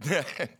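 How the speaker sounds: short burst of mock laughter; imitated small laugh. Preacher imitating the laughing evangelist starting up, small mock laugh. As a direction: let out a brief, put-on chuckle. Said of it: "Started a little bit".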